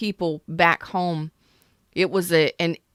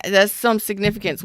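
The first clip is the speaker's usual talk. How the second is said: ordinary delivery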